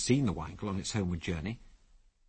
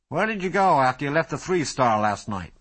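sharp, louder, higher demand; crisp question; 5 semitones higher, 6 dB louder than usual. A narrator's reading. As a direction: fire it off crisply. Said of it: "he asked crisply".

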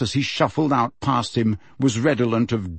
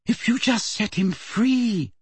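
hopeful; 11 semitones higher, slower than usual. A narrator's reading. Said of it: "said Hermione hopefully".

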